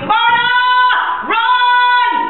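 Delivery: very high, loud cry to run; urgent shout. Acted. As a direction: scream the name across a distance. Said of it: "hear your mother calling you".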